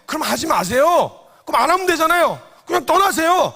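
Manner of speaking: high, loud shout; loud inner voice. Retold or imitated. The inner voice shouting at the believer to just leave, loudly. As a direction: yell it out defiantly.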